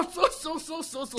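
high repeated club shouts; high-pitched rhythmic drill yell; drill shouting. Acted. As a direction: chant the greeting as the next pair. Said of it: "また次の ペアが".

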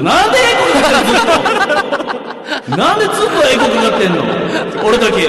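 loud, incredulous protest through echo; laughing complaint with a big echo; high, echoing outburst of disbelief. Real, not acted.

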